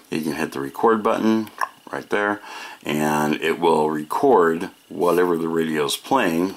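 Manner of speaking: ordinary talk, nothing marked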